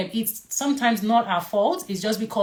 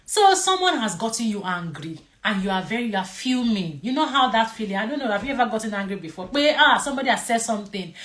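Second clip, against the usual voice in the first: ordinary delivery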